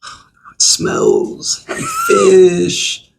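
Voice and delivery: high, slow, drawn-out disgusted whine; dramatic disgust. Real, not acted. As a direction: overplay how gross it smells. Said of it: "I'm being dramatic".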